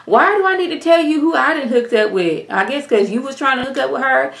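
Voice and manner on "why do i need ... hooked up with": much higher, sassy pushback; imitating a defensive snap; incredulous retort. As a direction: throw it back defensively. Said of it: "I'm like".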